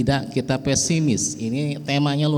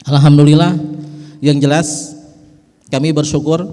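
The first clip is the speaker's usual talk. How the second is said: a much louder, rising burst of praise; loud exclamation; 5 semitones higher, 8 dB louder than usual. Real, not acted. Speaker praising God before the audience, loud rising cry. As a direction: throw out the praise to the whole room.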